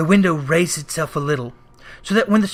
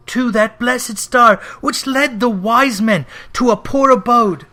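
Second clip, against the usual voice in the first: higher, louder mournful ghost voice pleading over wasted years; ghost's lament; 5 semitones higher, 4 dB louder than usual. Acted. Marley's ghost mourning his past to Scrooge, a higher, louder lament. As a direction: cry out your regret like a chained spirit.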